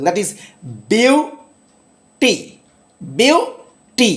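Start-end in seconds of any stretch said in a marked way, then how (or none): 0.9-2.3 "beauty" split slowly, "বিউ" high and stressed; stress on first syllable. Shown as a demonstration. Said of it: "সেটা হলো first syllable". 3.0-4.1 "beauty" split slowly, "বিউ" high and stressed; stress on first syllable. Shown as a demonstration. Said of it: "এই যে বিউর ওপর".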